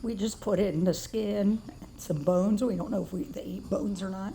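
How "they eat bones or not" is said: normal talking voice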